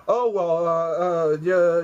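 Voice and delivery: mock flustered stammer; lightly voiced caught-out thief, stammering. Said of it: "kinda stammering and shit".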